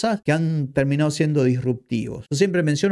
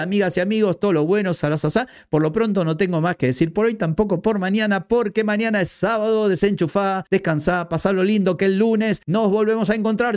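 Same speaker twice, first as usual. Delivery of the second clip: higher, bright host sign-off voice; podcast outro; 6 semitones higher than usual. Acted. Host signing off to listeners, upbeat announcer read. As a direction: wrap up the episode like a radio host.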